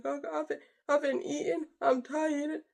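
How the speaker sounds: high, teary confession; crying. Retold or imitated. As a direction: break down while admitting she hasn't eaten. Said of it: "and I started crying".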